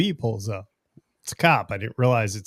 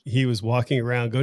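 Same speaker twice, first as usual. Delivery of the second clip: usual speaking voice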